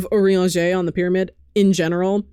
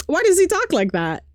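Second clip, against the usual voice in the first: exasperated question; 9 semitones higher than usual. Real, not acted. Exasperated about Urianger's speech.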